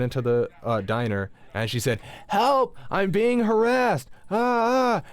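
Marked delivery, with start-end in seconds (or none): 2.3-5.0 high, loud hammed-up cry for help; mock screaming lady. Lady running into the diner, shrieking for help in mock panic. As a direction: shriek it like a woman faking an attack.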